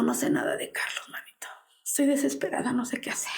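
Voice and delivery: hushed, broken whisper of a frightened mother; desperate whisper. Retold or imitated. Mother whispers to her brother, desperate for news of her son. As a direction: barely voice it, at a loss what to do.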